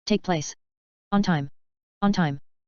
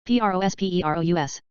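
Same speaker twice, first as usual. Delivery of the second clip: letters rattled off fast; quick spelling; faster than usual. Shown as a demonstration. Teacher spelling the word fast for the class. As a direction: run through the letters briskly. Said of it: "spelling drill".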